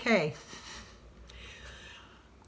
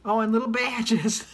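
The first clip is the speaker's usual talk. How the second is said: usual speaking voice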